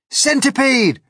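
high, loud call by name; urgent yell. Acted. James summons the centipede, yelling. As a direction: yell to get someone's attention. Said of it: "yelled James".

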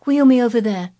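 put-on high, loud imperious boy; ringing boyish command. A narrator's reading. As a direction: order it like a young prince. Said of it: "he commanded".

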